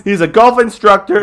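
loud, high hammed-up hype; mock hype. To listeners, hammed-up loud praise of the sponsor. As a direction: rave about him like a pitchman.

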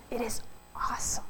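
hushed, breathy half-whisper; awed hush. Real, not acted. Preacher telling the congregation of holy street encounters, in an awed hush. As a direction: let it drop almost to a whisper in awe. Awed close of a moving story.

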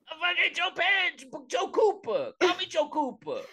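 high-pitched Brooklyn tough-guy voice; Joe Pesci impression. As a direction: ham up a Brooklyn gangster accent. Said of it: "Joe Pesci's Bradley Cooper".

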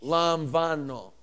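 slow teaching model of "Lamvano"; Greek pronunciation. Shown as a demonstration. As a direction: draw out "Lamvano" as lahm-VAH-no. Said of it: "it's not B, it's V".

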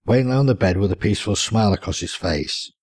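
plain reading voice

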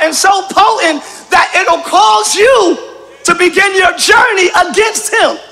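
loud, driving sermon delivery; full-force preaching. Acted. Pastor preaching loudly to the church about thanksgiving. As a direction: preach it hard to the congregation.

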